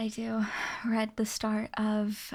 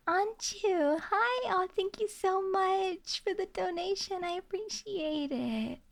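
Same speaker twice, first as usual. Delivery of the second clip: bright, much higher squeal of thanks; excited greeting; 9 semitones higher than usual. Real, not acted. Streamer gushing at a donor, excited high greeting. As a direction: gush over the donation.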